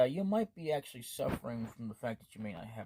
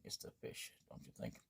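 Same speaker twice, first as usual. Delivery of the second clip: whispered aside; 13 dB quieter than usual. Acted. Hero whispering that the fish is to blame. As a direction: whisper "it's the fish" softly.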